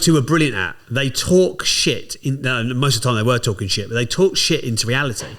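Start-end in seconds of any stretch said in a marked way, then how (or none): 0.9-2.2 slow emphatic punch. Real, not acted. Emphatic verdict on partners.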